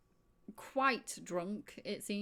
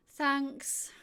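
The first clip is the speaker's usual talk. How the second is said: sighing thanks; 9 semitones higher, 6 dB louder than usual. Acted. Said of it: "kind of sighs".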